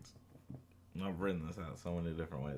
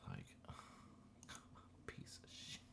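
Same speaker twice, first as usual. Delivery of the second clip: whispered, half-laughing curse; muttered curse; 17 dB quieter than usual. Real, not acted. Frustrated coder whispering a curse at broken code. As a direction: hiss it under your breath at the screen.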